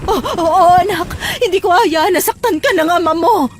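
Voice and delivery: high, emotional reassurance; distraught mother. Acted. Distraught mother promises protection. (balisang pangako ng ina)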